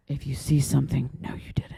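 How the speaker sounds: imitated grave warning, dropping to a whisper; very serious. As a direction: warn seriously, then hush it right down. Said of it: "she is very serious".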